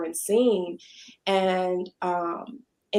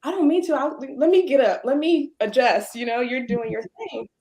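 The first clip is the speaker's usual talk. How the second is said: higher, lightly acted scramble to back off; apologetic fluster; 4 semitones higher than usual. Owner apologizing to the nervous painter, higher and hurried. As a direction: fumble an apology, voice raised. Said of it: "And I said".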